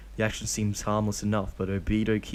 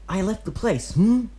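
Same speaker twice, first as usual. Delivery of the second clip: put-on high, cocky kid boasting; proud boy voice; 12 semitones higher, 6 dB louder than usual. A narrator's reading. Kid boasting to Obito that he left, proud. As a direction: puff up like a tough twelve-year-old. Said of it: "he proudly says".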